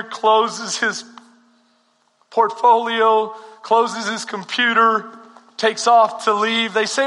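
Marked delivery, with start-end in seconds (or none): none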